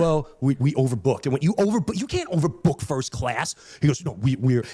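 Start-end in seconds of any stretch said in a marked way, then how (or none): 0.0-1.2 low, halting sheepish excuse. Retold or imitated. Airline worker explains the overbooking, sheepish. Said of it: "he was so sheepish". 4.0-4.6 sheepish. Retold or imitated. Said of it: "he was so sheepish".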